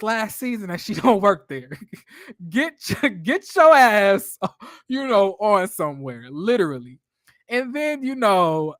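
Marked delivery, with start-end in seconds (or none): none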